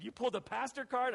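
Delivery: mock-shocked brother teasing; incredulous. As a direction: brother ribbing him in disbelief, mock-surprised.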